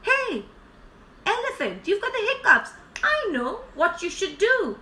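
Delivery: high-pitched, eager zebra voice; chirpy zebra. Acted. Zebra hails the hiccuping elephant, eager and high. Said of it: "Zebra said".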